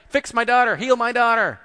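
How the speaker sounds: high, loud desperate demand; acted-out yelling of a pleading mother; shouting. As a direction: shout it across the crowd for help. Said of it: "She's over here shouting".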